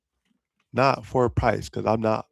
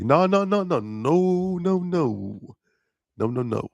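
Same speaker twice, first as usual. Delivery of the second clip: high excited no; 8 semitones higher, slower than usual. Real, not acted. Refusing to tell.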